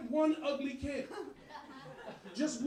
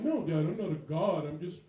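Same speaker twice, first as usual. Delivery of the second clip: low, formal son voice; stiff nerdy son; 6 semitones lower than usual. Retold or imitated. Son calling his dad, low and overly proper.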